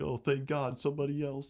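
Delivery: half-hearted weepy thank God; mock relieved crying. Host imitating a lone survivor finding someone, mock teary. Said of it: "like, crying".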